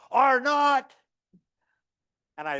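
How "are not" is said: high, louder mock shout of a defiant kid; a kid shouting out in class to contradict the teacher. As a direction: blurt it out like a stubborn boy. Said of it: "He just shouted out".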